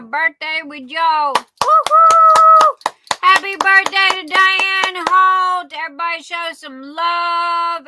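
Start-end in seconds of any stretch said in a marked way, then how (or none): 1.3-2.8 high, loud woo-hoo; birthday whoop for Diane; cheer. Real, not acted. 3.2-7.9 excited shout-out. Real, not acted. Birthday shout-out to viewer.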